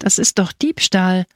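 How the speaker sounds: ordinary delivery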